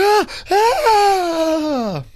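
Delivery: high, loud panicked scream breaking into a laugh; replayed scream of terror with a laugh; retold scream. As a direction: scream like you're falling, then laugh at it. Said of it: "the way you were screaming".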